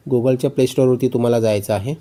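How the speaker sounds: ordinary talk, nothing marked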